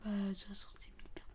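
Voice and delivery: hushed fast whisper. Pretend. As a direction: whisper it quickly and secretly. Pretend child phoning police.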